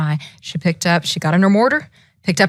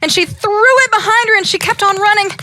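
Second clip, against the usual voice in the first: higher, louder and fast; excited; 13 semitones higher, 4 dB louder, faster than usual. A narrator's reading. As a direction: race through the escape. Exciting chase narration.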